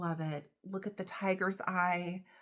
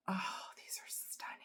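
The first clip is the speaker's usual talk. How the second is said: tearful whisper, much softer; emotional hush; 10 dB quieter than usual. Real, not acted. Vlogger sees gifted earrings, emotional whisper. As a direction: whisper it, close to crying. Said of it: "I was trying to get emotional".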